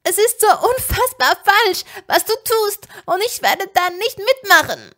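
loud, high, desperate refusal; outraged shouting. A narrator's reading. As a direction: shout it in fierce protest. Ginny shouts her refusal at Tom. (aufgebracht und laut geschrien)

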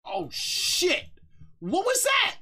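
high, loud startled yelp; real fright at a loud blast; startled shout. Real, not acted. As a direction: jump at the sudden noise and cry out.

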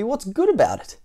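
high, indignant storybook retort; Mister Grumpy. A narrator's reading. Grumpy neighbour rebuffs a greeting, indignantly.

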